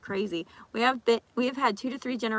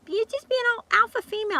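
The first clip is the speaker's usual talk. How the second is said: much higher, fast, put-on snippy voice; mocking accuser; 12 semitones higher, 4 dB louder, faster than usual. Gym member calling [name] an alpha, fast and high. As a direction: sneer it quick and high like a snotty critic. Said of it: "I got called an alpha".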